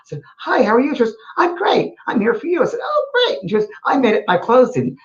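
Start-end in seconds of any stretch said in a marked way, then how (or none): 0.4-0.9 high cheery hello; bright greeting. Retold or imitated. Medium greeting a stranger on a cruise, high cheery hello. 1.4-5.0 high cheerful lady's voice; lightly voiced Jordy. Jordy meeting the medium on the gangplank, lightly voiced high cheer. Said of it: "this lady".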